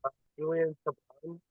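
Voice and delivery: faint, far-off voice on a weak line; barely audible. Real, not acted. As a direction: speak so softly you barely come through. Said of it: "You sound really faint to us".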